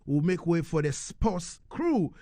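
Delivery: ordinary talk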